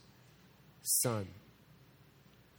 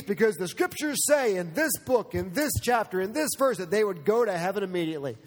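higher, louder sneering caricature of a callous advocate; mock the heartless voice, scornful and raised; mocking abortion advocate; 3 semitones higher, 3 dB louder than usual. Preacher mocks abortion advocates, sneering raised caricature.

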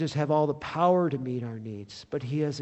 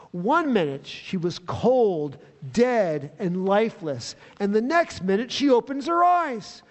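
ordinary talk, nothing marked

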